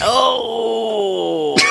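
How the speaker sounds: groaning laugh at a pun; groan, then laugh at the joke. Real, not acted.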